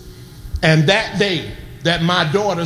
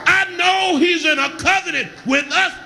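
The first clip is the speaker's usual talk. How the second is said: raised, ringing preacher's cry over the organ; full-force preaching; 10 semitones higher, slower than usual. Acted. Preaching, shouting over music.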